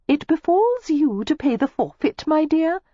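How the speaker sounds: high, bright hostess voice; merry hostess. A narrator's reading. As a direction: announce the forfeit sweetly. Hostess announcing forfeit.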